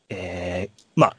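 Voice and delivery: ordinary talk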